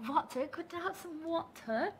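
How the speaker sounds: a try at British "water" with no r at the end; mock-British "water", open a and crisp t; imitating British accent. Shown as a demonstration. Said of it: "Did I say it right?".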